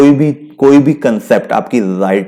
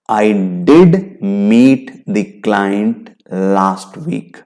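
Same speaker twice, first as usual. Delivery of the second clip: "did" pressed firmly, slow and deliberate; stress on did; 2 semitones lower, much slower than usual. Shown as a demonstration. Teacher demonstrating emphasis against a blame. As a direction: lean on "did" in "I did meet the client". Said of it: "emphasise कर रहा हूं".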